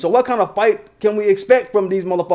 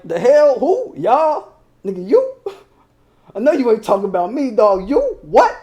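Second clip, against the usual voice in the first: incredulous disbelief; 2 semitones higher than usual. Retold or imitated. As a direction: jump way up in pitch, can't believe it. Incredulous tweet reply.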